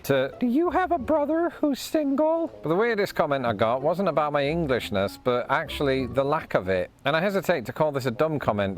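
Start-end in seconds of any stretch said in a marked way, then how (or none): 0.4-2.5 very high put-on caller voice; imitated flirty caller. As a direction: mimic a caller fishing for a date, pitched way up.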